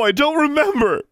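very high, anguished shout. A narrator's reading. Girl driven to breaking by questioning, shouted. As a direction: yell it at the top of your voice. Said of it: "Alexandra shouted".

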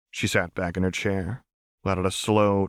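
plain reading voice

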